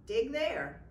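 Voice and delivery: lightly voiced leprechaun, matter of fact; a hint of a sly leprechaun giving orders. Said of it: "said the leprechaun".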